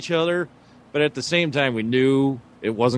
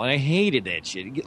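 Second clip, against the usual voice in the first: ordinary delivery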